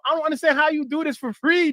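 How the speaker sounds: usual speaking voice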